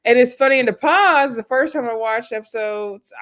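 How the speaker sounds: normal talking voice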